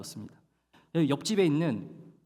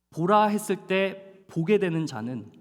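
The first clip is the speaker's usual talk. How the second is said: ordinary talk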